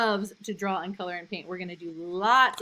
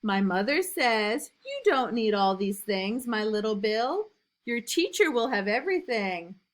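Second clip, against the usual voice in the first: lively, louder storybook reading; reading to children; 4 dB louder than usual. A narrator's reading. Teacher reading a picture book to new kindergartners, lively and loud. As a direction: read it big and bouncy for little kids.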